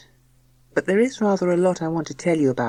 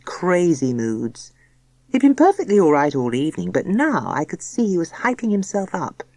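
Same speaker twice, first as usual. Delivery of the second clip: ordinary delivery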